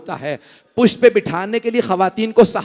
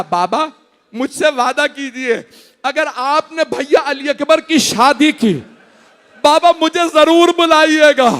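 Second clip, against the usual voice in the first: raised, keening lament recitation; grieving recitation pitched higher; majlis lament; 9 semitones higher, 6 dB louder than usual. Acted. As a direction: lift the voice into a mournful plea. Mourning recitation of Karbala.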